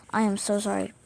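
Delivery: usual speaking voice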